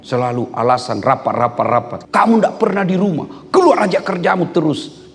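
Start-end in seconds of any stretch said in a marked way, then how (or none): 2.1-5.1 high-pitched mock nagging; quarrelling wife. Wife accuses husband of never being home, quarrelling. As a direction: complain sharply in a raised pitch. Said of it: "Oh, bertengkar".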